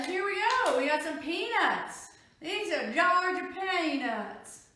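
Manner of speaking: playful fake Georgia accent, slow on the last line; bad Southern accent. Showing off Georgia peanuts in a mock Southern voice. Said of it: "I know, that's really bad".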